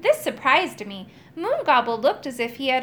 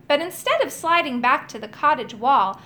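plain reading voice

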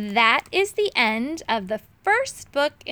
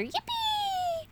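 much higher squeaky mouse cheer; a chorus of little mice cheering in a hat; tiny mice cheering; 7 dB quieter than usual. A narrator's reading. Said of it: "The mice in his hat began to cheer".

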